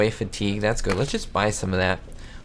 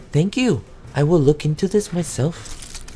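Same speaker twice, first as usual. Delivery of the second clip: high-pitched in-character thanks; lightly put-on higher voice; game hero's reply; 6 semitones higher than usual. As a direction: answer politely in a raised voice. Voices Kim thanking the letter writer.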